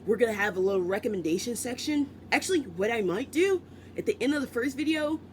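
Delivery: ordinary delivery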